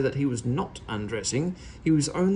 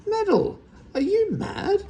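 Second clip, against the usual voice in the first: high, loud shocked cry; 4 dB louder than usual. A narrator's reading. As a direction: shriek at him in disbelief. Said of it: "cried his aunt".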